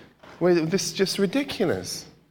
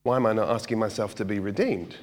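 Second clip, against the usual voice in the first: quiet self-question; 8 semitones lower, 6 dB quieter than usual. Retold or imitated. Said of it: "ask yourself the question".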